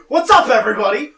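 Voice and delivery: high, very loud hello; shouted greeting. Real, not acted. Co-host greeting the listeners, shouted greeting. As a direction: holler it to the whole audience. Greets audience after arriving.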